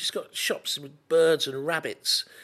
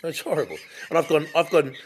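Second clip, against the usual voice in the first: ordinary talk, nothing marked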